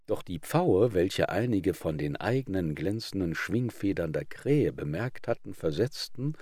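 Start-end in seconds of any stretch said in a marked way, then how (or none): none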